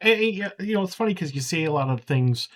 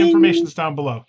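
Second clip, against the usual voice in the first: YouTuber hype voice; 7 dB louder, faster than usual. Pretend. YouTuber outro bit.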